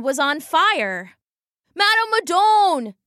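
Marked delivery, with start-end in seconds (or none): 1.8-2.9 put-on frantic boy yelling a name. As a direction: shout her name across the market. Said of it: "he yelled".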